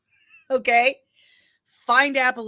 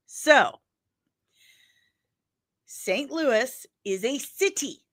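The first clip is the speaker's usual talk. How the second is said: ordinary talk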